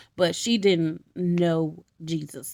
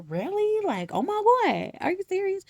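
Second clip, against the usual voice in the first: high, excited can't-believe-it voice; excited disbelief; 11 semitones higher than usual. Retold or imitated. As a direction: Ruth thrilled by Naomi's plan. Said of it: "she was like".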